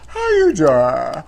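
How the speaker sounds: slow drawn-out talk show catchphrase; slow showy talk show greeting; Wendy Williams impression. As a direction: stretch it like a daytime host greeting the crowd.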